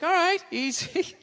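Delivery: easy. Retold or imitated. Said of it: "It's like".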